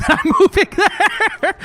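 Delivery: high, loud, laughing through it; slow words breaking up into laughter. Real, not acted. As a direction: crack up while talking. Cracking up at the town name.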